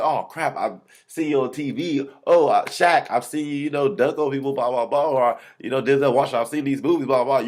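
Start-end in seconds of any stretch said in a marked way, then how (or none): 0.0-5.1 higher and louder, gushing at Shaq; star-struck fan babbling; fanboy geeking out. Retold or imitated. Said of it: "I would geek out". 5.8-7.5 higher and louder, gushing at Shaq; star-struck fan babbling; fanboy geeking out. Retold or imitated. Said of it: "You're gonna geek out".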